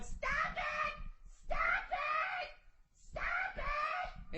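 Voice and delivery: high-pitched mock scream of a panicked woman; mock screaming lady. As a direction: shriek like a squeaky dog toy. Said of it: "this bitch is screaming".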